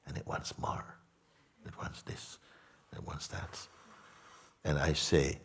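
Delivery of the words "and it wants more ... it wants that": dropped to a near whisper, softer; hushed craving. Acted. Teacher voicing the drug's craving in a hushed near whisper. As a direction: breathe the craving words almost unvoiced.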